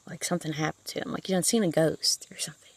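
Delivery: hushed, breathy ghost-story whisper; spooky whisper. Acted. Telling her viewers about the pale visitor, whispered. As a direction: lean in and whisper it like a secret.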